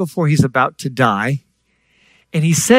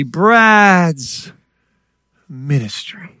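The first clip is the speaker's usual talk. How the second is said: slow, self-admiring "Brad's ministry"; mock self-importance; 3 semitones higher, much slower than usual. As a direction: savor your own name grandly, then hush on "ministry". Mocks his own former grand idea.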